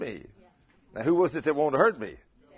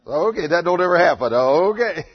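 much louder, knowing ironic tone; mock denial; 5 semitones higher, 12 dB louder than usual. Real, not acted. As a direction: say it with a wink. Ironic joke to audience.

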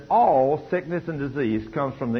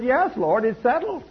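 fervent yes; 11 semitones higher than usual. Retold or imitated. Emily voiced answering God.

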